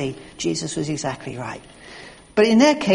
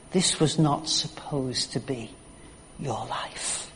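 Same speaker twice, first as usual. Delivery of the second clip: slow, low and softer, fading away; hushed repeat; 5 semitones lower, 8 dB quieter, slower than usual. Retold or imitated. Preacher repeating the thief's words, hushed and slow. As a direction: let it sink to a hush.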